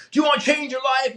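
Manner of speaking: high, loud rally cry to the crowd; hyped-up speaker shout. Retold or imitated. Seminar speaker firing up the audience, high loud shout. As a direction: whip the room up like a stage motivator.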